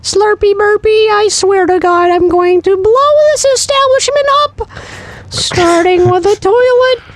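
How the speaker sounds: very high, screechy villain rant; megalomaniac rant. Retold or imitated. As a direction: shriek threats like an unhinged villain. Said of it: "sound something like".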